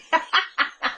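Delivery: short burst of high laughter; amused laugh. Real, not acted. Vlogger tickled by their own CSI parody, amused laugh. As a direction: crack up briefly at your own joke.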